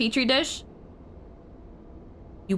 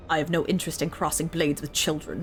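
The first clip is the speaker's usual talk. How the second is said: cold dismissive villain; 7 semitones lower, 4 dB quieter than usual. Acted. Villain dismissing the young heroes, low and cold. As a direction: dismiss the children from above.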